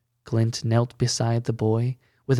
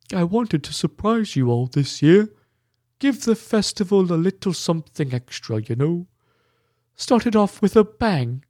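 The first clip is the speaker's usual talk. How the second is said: tearful man explaining; 6 semitones higher, 4 dB louder than usual. A narrator's reading.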